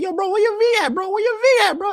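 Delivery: high, exaggerated Jamaican coworker voice; Jamaican accent. Coworker greeting him, high Jamaican accent. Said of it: "he's like".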